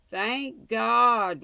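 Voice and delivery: loud, high outburst of relief; bursting exclamation. A narrator's reading. Lost traveller finding a man with a lantern, bursting out. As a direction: let it burst out, involuntary and loud. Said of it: "exclamation that burst involuntarily".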